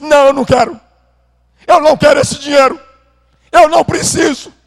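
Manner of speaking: high, loud tearful refusal; father shouts he won't take the money back; father refusing. Retold or imitated.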